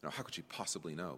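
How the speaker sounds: voicing an understated brush-off; mildly dismissive. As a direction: toss it off quickly and quietly, discounting her. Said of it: "discounted by Simon".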